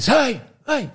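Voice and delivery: high-pitched mock holler. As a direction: call it out high, imitating an overheard row. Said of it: "you know, like, raise".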